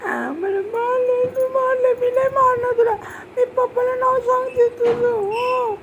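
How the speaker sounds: high, sustained wail; tearful high-pitched whining; crying complaint. Real, not acted. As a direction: wail the words out in long drawn notes. Child wails about being hit.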